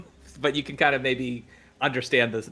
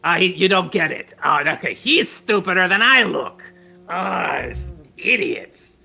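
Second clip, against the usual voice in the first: louder, fed-up scoff; louder exasperated scolding of Slickscale; exasperated outburst; 5 semitones higher, 7 dB louder than usual. Acted. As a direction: throw up your hands at idiots.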